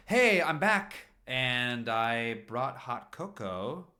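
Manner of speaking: loud, high, slow call through the house; calling out cheerfully. Acted. Paul calling to the boys as he comes home, loud and high. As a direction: sing it out from the front door.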